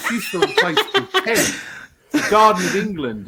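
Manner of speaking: giggling high-pitched answer; laughing. Real, not acted. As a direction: squeal it out through laughter. Laughing, retold own answer.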